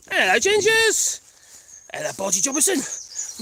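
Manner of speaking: high sing-song pet greeting; pet talk. Real, not acted. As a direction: coo at the animals. Greeting pets in doting voice.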